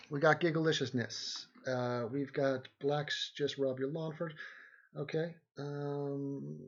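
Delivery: normal talking voice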